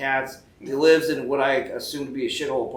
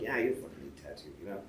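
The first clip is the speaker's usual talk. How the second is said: sarcastic reply; 12 semitones lower, 13 dB quieter, faster than usual. Retold or imitated.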